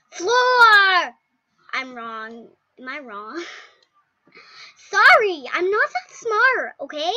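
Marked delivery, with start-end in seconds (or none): 0.1-1.1 loud call, much louder; shout. Real, not acted. Kid shouting the disaster's name at the game. 4.9-7.1 loud, higher defensive sorry; loud apology. Real, not acted. Kid loudly excusing her wrong guess to viewers.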